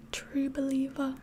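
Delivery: ordinary talk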